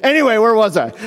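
mock casual brush-off; breezy denial. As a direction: act as if nothing happened. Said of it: "And he goes".

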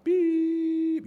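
one long high held beep; a voiced monitor tone, held high and steady; mimicked flatline beep. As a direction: imitate the heart monitor flatlining.

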